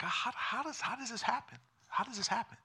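high, very soft bewilderment; hushed wondering to oneself; pondering. Retold or imitated. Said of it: "I've pondered this whole issue".

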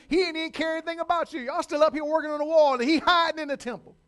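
loud, high taunting jeer; mocking enemies. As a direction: shout them down as scornful enemies. Enemies mocking Nehemiah in sermon.

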